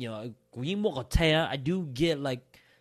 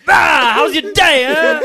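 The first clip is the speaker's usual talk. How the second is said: huge high, drawn-out yell; mock attack shout; 18 dB louder, slower than usual. Dad ambushes kid home from school, mock shouting. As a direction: burst out in a hammed-up roar. Acting sudden attack on child.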